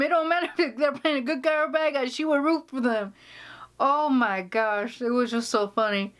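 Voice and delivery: normal talking voice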